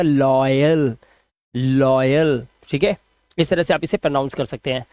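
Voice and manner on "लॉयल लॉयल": "लॉयल" drawn out low, oy gliding into l; pronunciation demo. Shown as a demonstration. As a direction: stretch "लॉयल" in a lowered, careful voice. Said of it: "आपको बोलना पड़ेगा".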